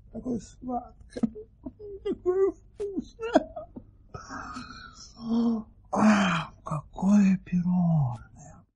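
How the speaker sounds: high, slow, moaning in bliss; swooning with delight. Acted. Sultan swooning to the baron over the cake, slow and blissful. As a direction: melt over every word of the taste.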